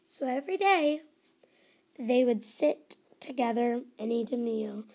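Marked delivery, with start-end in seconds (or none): none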